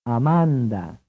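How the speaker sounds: Amanda with a long stressed middle "ah"; ah-MAAHN-dah; Italian pronunciation. Shown as a demonstration. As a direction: stretch the MAHN in Italian Amanda. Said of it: "Is this stressed vowel longer".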